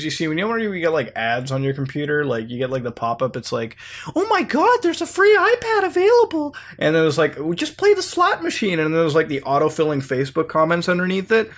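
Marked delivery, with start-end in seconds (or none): 4.2-6.6 squealing, very high-pitched ad voice; mock-excited pop-up in a tiny high voice; gushing pop-up ad. Mimicking excited pop-up ads. 7.4-8.8 very high put-on ad voice; squeaky mock advert come-on; pop-up ad. Mimicking pop-up ad names.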